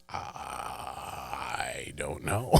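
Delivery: very low, very slow, dragged-out mumble; a deep slowed-down mock of a dazed wrestler; dazed mock reply. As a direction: play him totally lost and drained.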